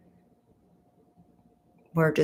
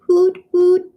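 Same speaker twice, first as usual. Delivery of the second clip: high, loud playful owl hooting; two high hoots like an owl; 12 semitones higher, 9 dB louder than usual. Acted. Streamer agreeing she's a night owl, playful owl hoot.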